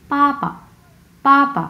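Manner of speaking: পাপা with a high first syllable and short dropped second; fourth tone, then neutral. Shown as a demonstration. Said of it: "প্রথম অংশ হচ্ছে চার number tone".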